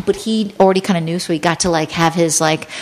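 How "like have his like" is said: ordinary talk, nothing marked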